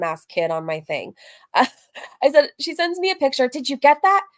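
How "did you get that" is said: ordinary delivery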